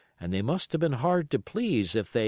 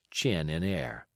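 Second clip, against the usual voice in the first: plain reading voice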